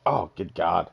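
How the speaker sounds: ordinary talk, nothing marked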